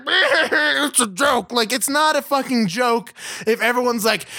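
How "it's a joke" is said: mock proud dad. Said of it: "This is like".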